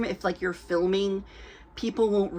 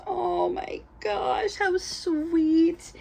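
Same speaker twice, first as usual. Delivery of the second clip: gushing wife; 9 semitones higher, much slower than usual. Retold or imitated. Wife admiring the baby.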